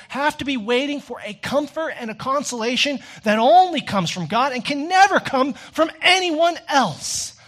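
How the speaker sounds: high, loud, driving sermon delivery; preaching at full force. Acted. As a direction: preach it hard and urgent to the congregation.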